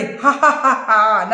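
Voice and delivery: big acted boss's laugh; forced villain laugh. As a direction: force out a slow ha-ha-ha for the gang. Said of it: "నవ్వాడు రాంబాబు". (బలవంతపు villain నవ్వు)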